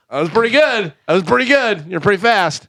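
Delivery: high-pitched mock amazement; high, impressed compliment to the doctor; impressed reaction, retold. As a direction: retell it pitched well up, pleasantly astonished.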